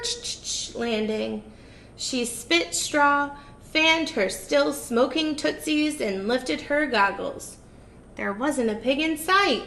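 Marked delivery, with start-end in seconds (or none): none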